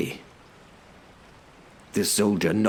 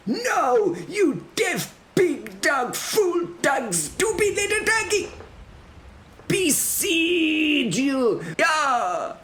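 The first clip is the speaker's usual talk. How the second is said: put-on very high, exasperated accented tirade; raging foreign herald; slower than usual. Herald corrects the deaf defenders, high furious rant. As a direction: shriek the insults, stretching the final threat. Herald rages at being misheard.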